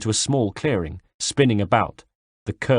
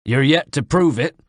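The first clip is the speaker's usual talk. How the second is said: higher, louder sneering voice; taunting villain; 5 semitones higher, 4 dB louder than usual. Acted. Knife man goads the narrator to fight. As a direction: dare him to prove it.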